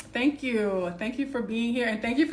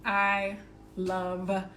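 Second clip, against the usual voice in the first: slow, earnest affirmation read; slower than usual. Acted. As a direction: savour each phrase like a mantra. Reads affirmations over music.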